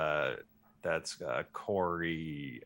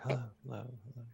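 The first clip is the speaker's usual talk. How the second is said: canyon echo; 3 semitones higher, 9 dB quieter, much faster than usual. Shown as a demonstration. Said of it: "and then it goes like".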